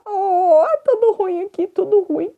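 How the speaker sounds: whiny. Real, not acted. Whining about implant.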